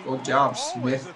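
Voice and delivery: ordinary talk, nothing marked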